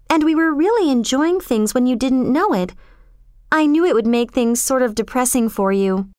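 put-on indignant, then breezily untroubled girl; defensive young girl. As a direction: argue it lightly in a high girl's voice.

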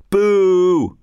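high, sustained heckle; yelled jeer. A narrator's reading. Someone in the crowd heckling soldiers, yelling. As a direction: boo them in a high, held call. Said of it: "Somebody else yelled".

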